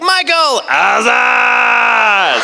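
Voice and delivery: high, drawn-out holler; gleeful greeting shout. Retold or imitated. Japanese guests greet the actor all weekend, hollering. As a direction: imitate guests hailing a friend loudly. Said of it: "they were like".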